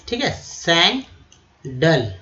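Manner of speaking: सैंडल drawn out slowly, "sain-dal"; careful pronunciation. Shown as a demonstration. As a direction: model सैंडल slowly for the class. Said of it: "जो शुद्ध है". (सैंडल को धीरे साफ़ उच्चारण करें)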